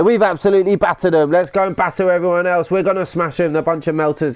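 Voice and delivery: crank it up like a cocky lad winding up rivals; hyped-up laddish boast. Shown as a demonstration. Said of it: "That's probably more like what I'd say".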